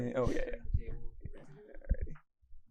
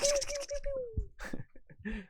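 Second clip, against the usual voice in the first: rapid high-pitched laser fire imitation; mouthed full-auto laser volley; pew-pew laser noises. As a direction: make a long burst of toy laser shots. Imitating laser gunfire as sound effect.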